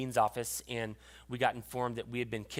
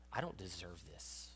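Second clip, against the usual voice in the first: softer, slow, lower; hushed inner thought; 5 semitones lower, 11 dB quieter, slower than usual. Retold or imitated. Preacher recalling his humbled thought, hushed and slow. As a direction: breathe it out, undone.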